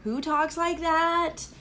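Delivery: mocking her own Valley girl accent. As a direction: camp up the Valley girl twang. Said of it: "talk like a Valley girl".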